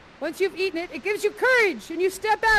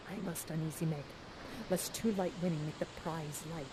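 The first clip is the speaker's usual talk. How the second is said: much softer, lower conspiratorial murmur; hushed aside; 10 semitones lower, 15 dB quieter than usual. Real, not acted. Huddling with the spirits, hushed and conspiratorial. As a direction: let the spirits in on a secret. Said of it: "Come close".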